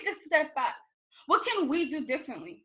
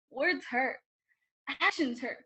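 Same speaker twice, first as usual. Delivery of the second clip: ordinary talk, nothing marked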